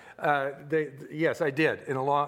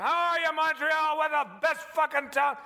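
hammy stage shout; 9 semitones higher than usual. Retold or imitated. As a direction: pump up the crowd, over the top. Hammed-up stage greeting.

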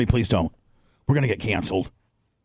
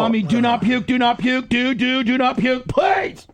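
high, urgent begging; desperate pleading; 6 semitones higher than usual. Real, not acted. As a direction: plead over and over. Begging friend not to vomit.

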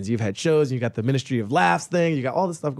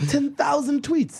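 high, slow, gushing mock naivety; mock excitement, pitched way up; naive past self; 13 semitones higher, slower than usual. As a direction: draw it out high like a clueless first-timer. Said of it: "I was like".

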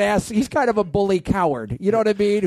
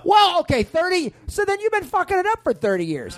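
angry comeback; 10 semitones higher than usual. Acted. Bandleader mocking bandmate's thirty years, high angry retort. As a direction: burst in with outrage. Acted quarrel with band.

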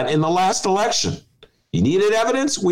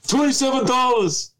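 higher, emphatic politician impression; Bernie Sanders; 7 semitones higher than usual. Senator citing his donations, mock imitation. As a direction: declare it high, like a stump speaker. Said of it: "you're going to Bernie Sanders".

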